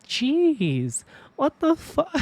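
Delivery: jumps way up into a shocked squeak; stunned, the pitch flies up at the end; shocked disbelief. Retold or imitated. She shrieks in disbelief at the brawling strangers.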